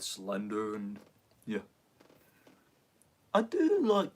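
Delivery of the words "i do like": much higher, loud, emphatic; excited outburst. Real, not acted. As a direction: blurt it out eagerly.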